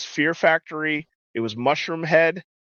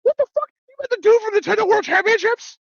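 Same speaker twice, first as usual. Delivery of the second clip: very high squeal of disbelief; hammed-up incredulous reaction; retold amazement; 4 dB louder than usual. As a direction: squeak it out, totally floored. Retold amazed reaction to friend's news.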